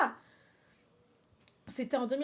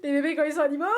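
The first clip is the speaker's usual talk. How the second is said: laughing at her own slip, louder; 4 semitones higher, 8 dB louder, much faster than usual. Real, not acted. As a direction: say it through laughter.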